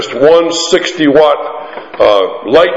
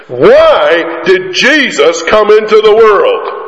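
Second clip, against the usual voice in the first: slow and drawn out, inviting a group answer; slow prompting question; 6 semitones higher, slower than usual. Shown as a demonstration. Preacher cueing the congregation to reply, slow and drawn out. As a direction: draw the question out so they answer together. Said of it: "you can answer in unison".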